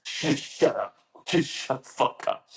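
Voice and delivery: high, airy scolding whisper; imitated urgent shushing; mock hissed warning. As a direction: hush them harshly under your breath. Retold hushing of fellow trainees.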